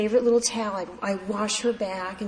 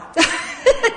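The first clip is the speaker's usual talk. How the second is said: high burst of laughter; loud bright laugh; 6 dB louder than usual. Real, not acted. Laugh at own answer.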